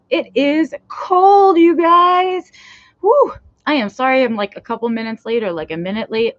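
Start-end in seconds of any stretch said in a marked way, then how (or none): 0.1-3.3 shivering cold whine. Real, not acted. Complaining about the cold.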